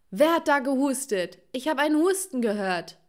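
loud, high-pitched interrogation; angry demand. A narrator's reading. Mother storming at her children over a cough, angry demand. Mother charges in like raging bull.

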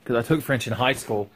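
ordinary talk, nothing marked

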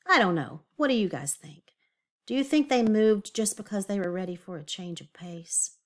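usual speaking voice

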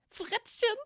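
very high, silly tipsy squeak; drunk, giggly Goyle. Acted. Drunken Goyle giggles at Malfoy's nickname, high and silly. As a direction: echo it as a tittering drunk question. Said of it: "kicherte der, kicherte der ziemlich betrunkene Goyle".

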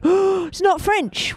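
high, slow, scandalised exclamation after a gasp; gasp, then draw it out in high-pitched disbelief; mock shock. Host discovering an English song among the French ones, mock-shocked.

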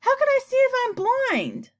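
very high, loud outraged question; high-pitched, worked-up storybook fury; indignant shout. A narrator's reading. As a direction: shout it in exasperation. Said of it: "shouted the poor earthworm".

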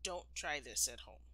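usual speaking voice

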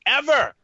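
ever hit high and loud; a final punched ever; emphatic ever. Real, not acted. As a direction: slam the word ever home. Emphatic tag after sentence.

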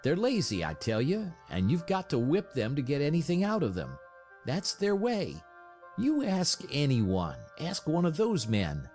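high-pitched, irritated complaint; sneering. A narrator's reading. Hal arguing with his sister about dogs, irritated sneer. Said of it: "her brother sneered".

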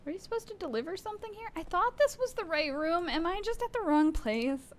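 high-pitched, flustered questioning; confused. Real, not acted. Player unsure of the delivery room, high confused voice. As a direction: pitch it way up in bewilderment.

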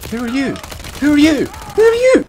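rising from a question to a high loud shout; building yell. Real, not acted. Gamer shouting at an unknown enemy, escalating yell.

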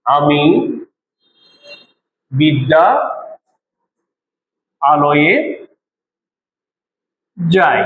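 long pauses between আমি, বিদ্যা, আলোয়ে, যাই; word by word. Shown as a demonstration. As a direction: separate each word of the old phrase. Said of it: "আগে এরকম বলা হতো". (প্রতিটি শব্দ আলাদা করে থেমে থেমে)

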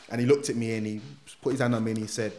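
usual speaking voice

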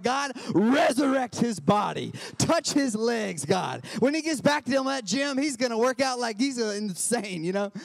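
loud Pentecostal-style pleading prayer; fervent loud prayer. Retold or imitated. Pastor praying over the gym-goer, loud and fervent. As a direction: pray hard over the fallen man. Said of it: "I was like".

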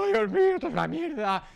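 lamento agudo y fuerte de pánico; estudiante desesperado. Retold or imitated. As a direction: imita a alguien al borde del llanto. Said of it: "gente llorando". (estudiante angustiado gritando en la puerta del examen)

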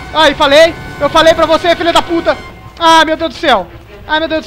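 loud frightened yelling; panicked yelling. Real, not acted. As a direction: shout it in real panic. Panicked streamer yelling at game.

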